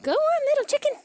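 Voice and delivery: high shrieking shoo; shooing call. Real, not acted. Gardener shooing a chicken out loudly. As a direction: chase it out with your voice.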